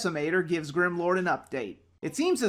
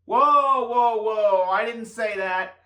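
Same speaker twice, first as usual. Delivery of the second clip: startled loud protest; 3 semitones higher, 3 dB louder, slower than usual. Real, not acted. Protesting against a voiced suggestion.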